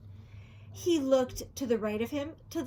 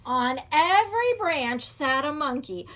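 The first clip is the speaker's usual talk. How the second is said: ordinary delivery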